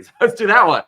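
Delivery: loud, high laughing conclusion; gleeful laughing decision. Retold or imitated. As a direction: burst out laughing as you say it. Team choosing bucket, laughing.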